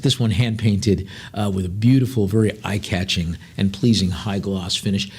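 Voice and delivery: usual speaking voice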